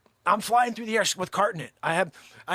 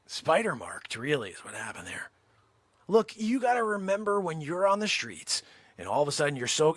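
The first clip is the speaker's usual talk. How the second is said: ordinary delivery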